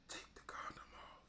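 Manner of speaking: breathy whisper in his ear. Retold or imitated. Retelling a girlfriend whispering in his ear. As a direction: whisper it close and low.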